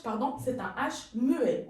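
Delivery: ordinary talk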